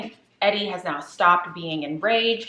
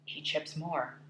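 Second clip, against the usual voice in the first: much softer, dropping toward a whisper; hushed spooky storytelling; 3 semitones lower, 11 dB quieter than usual. Acted. Telling viewers the gruesome discovery, hushed and spooky. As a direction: draw listeners in with a low, eerie hush.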